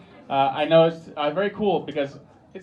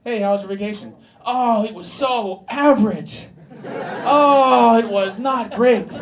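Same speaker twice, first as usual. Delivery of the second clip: Swede's enthusiasm; 5 semitones higher, 7 dB louder than usual. Retold or imitated. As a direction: gush about a dull holiday as if thrilled. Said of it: "that same level of enthusiasm from a Swede".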